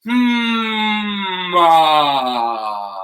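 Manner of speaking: loud hum opening to an open ah; vocal warm-up hum into ah. Shown as a demonstration. Coach demonstrating the warm-up sound to the class, hum to ah. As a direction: hum high, then release into a loud ah. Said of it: "make this sound".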